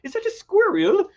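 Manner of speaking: put-on high, loud childlike Aussie wonder; like a five-year-old seeing squirrels; mock excited Australian. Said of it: "He's like".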